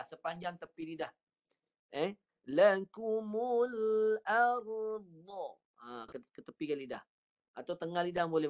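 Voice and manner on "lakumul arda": Lakumul arda drawn out slowly, dad from the tongue sides; slow recitation with dad. Shown as a demonstration. Teacher models dad for the student. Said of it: "guna tepi lidah".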